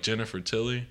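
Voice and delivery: ordinary delivery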